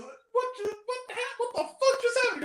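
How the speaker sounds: high-pitched mock bewilderment; hammed-up disbelief; acted shock. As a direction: blurt it high, as a movie freak-out. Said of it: "He goes".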